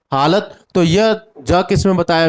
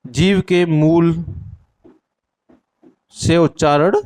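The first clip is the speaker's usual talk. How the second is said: slow dictation. Shown as a demonstration. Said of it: "मूल उच्चारण से इसका".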